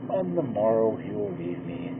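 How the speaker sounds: usual speaking voice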